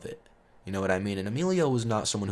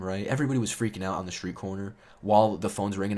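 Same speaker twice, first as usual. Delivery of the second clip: usual speaking voice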